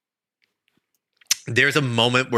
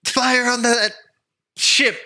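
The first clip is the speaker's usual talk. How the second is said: shouted order; 12 semitones higher than usual. Acted. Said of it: "as you shout".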